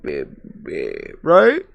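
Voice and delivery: ordinary talk, nothing marked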